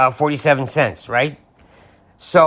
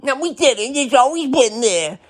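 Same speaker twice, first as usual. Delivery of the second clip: much higher mock whiny denial; mock supporter denial; 10 semitones higher than usual. Mocking supporters denying the name-calling, high whine. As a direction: squeak out the denial. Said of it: "Trump invented the name-calling".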